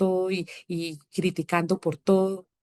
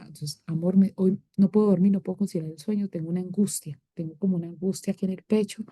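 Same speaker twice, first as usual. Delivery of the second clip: usual speaking voice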